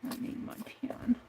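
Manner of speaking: soft whispered reminder; whispered to herself. Real, not acted. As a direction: whisper it as a note to yourself. Muttered note to herself.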